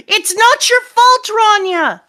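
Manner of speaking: high, loud pleading cry; impassioned reassurance. Acted. Adult to crying child Rania, impassioned.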